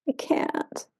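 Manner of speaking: very soft, nearly whispered; hushed inner voice of doubt; inner "I can't" voice. Acted. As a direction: murmur it like a private defeated thought. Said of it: "And that voice shifted over time".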